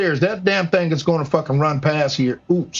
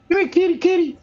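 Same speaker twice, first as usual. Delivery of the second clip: high sing-song kitty call; 11 semitones higher than usual. Real, not acted. Streamer calling his cat to hunt the mouse, high sing-song. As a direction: coo for the cat to come over.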